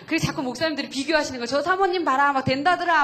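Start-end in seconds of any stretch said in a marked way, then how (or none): 1.5-3.0 louder, higher mock quote of other pastors; loud mock praise. Pastors comparing their wives, in loud mock praise. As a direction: ham up the pastors bragging about her. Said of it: "막 이러고".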